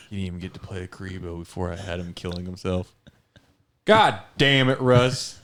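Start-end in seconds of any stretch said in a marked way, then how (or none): 3.9-5.4 loud, high angry shout; angry yell. Acted. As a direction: snap at him in fury. Skit partner exploding.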